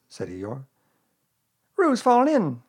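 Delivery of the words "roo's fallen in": high, loud cry of alarm; Rabbit. A narrator's reading. Raising the alarm as Roo falls in, crying out. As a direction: cry out the emergency. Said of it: "cried Rabbit".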